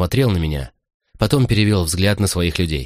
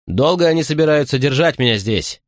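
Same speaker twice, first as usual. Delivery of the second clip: mildly raised, higher-pitched call; calling after someone; 7 semitones higher than usual. A narrator's reading. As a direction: call out a question after a departing guard. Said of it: "крикнул я ему вслед". (негромкий окрик вслед, голос выше)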